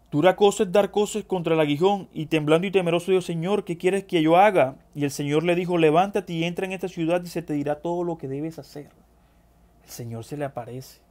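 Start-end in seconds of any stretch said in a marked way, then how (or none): 0.1-8.9 higher, louder reading voice; scripture reading. Acted. Preacher reading Saulo's encounter to the brethren, projected scripture reading. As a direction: read the verses out with projection. Reads the verses aloud.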